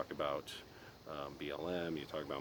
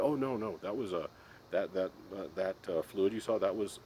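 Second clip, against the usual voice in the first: laughing while quoting; 3 semitones higher, 7 dB louder than usual. Real, not acted. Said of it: "I can't even say it without laughing".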